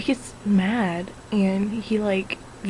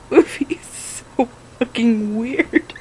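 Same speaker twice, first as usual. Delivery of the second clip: ordinary talk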